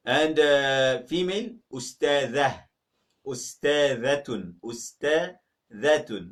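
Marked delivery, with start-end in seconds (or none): 3.3-6.3 slow model of Ustadhatun; Arabic dh sound. Shown as a demonstration. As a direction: stretch Ustadhatun out, dh clear. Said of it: "Not ustadatun".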